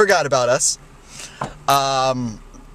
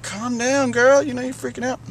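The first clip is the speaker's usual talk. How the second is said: a lightly voiced, higher, mock-soothing clerk; clerk calming her; 10 semitones higher than usual. Clerk to the upset mother, higher mock-calming. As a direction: brush off the angry customer. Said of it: "The guy was like".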